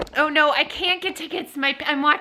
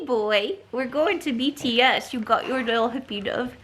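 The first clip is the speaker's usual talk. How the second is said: ordinary talk, nothing marked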